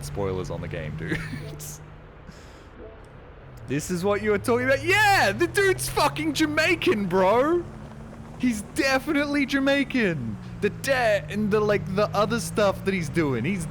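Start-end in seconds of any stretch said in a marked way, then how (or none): 1.2-3.6 high airless wheezing laugh; breathless wheeze laugh. Real, not acted. 3.7-12.8 high loud excited shouting; excited yelling. Real, not acted. Excited outburst about game voice.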